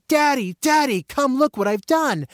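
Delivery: high, loud, drawn-out call to Daddy; proud kid shouting for a parent; excited child's scream. Acted. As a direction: call out like a thrilled four-year-old. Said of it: "You scream".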